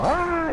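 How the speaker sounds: high playful scream, echoing; a toddler's happy shriek, retold; imitated toddler scream. Dad imitating his daughter's slide scream, high playful shriek. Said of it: "and I'd go".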